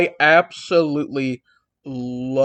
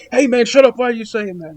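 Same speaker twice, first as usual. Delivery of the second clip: mock freak-out; 5 semitones higher than usual. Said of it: "DDP is like, he's freaking out".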